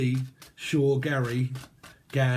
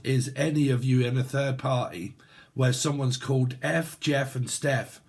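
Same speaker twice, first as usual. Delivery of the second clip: ordinary delivery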